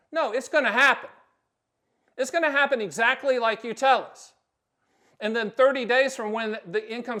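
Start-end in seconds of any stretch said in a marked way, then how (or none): 0.1-4.3 louder, higher, leaning hard on gonna; emphatic insistence. Real, not acted. Advisor assures clients it will happen, emphatic and loud. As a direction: hammer home that "gonna" means it will happen. Insisting against vague agent promises.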